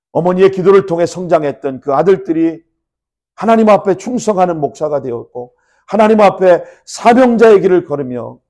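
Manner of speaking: loud, high pulpit proclamation; ringing, emphatic preacher; impassioned preaching. Real, not acted. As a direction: drive each phrase out with force. Preaches at full force.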